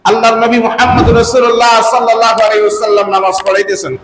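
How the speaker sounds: ordinary talk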